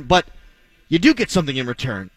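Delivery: usual speaking voice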